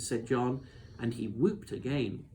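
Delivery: ordinary delivery, nothing marked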